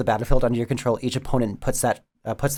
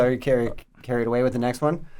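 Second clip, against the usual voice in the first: usual speaking voice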